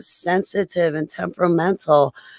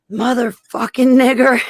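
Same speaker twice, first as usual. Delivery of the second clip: retold outburst; 8 semitones higher, 5 dB louder than usual. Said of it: "And I'm like".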